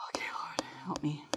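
hushed plea to the Lord; whispered prayer. Retold or imitated. Teacher praying silently for help with a disruptive student, whispered. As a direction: pray under your breath.

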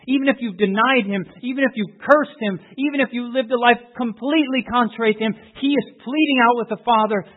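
loud, rising pulpit fervour; full-throated revival preaching; preaching at full force. Acted. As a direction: preach it out, building.

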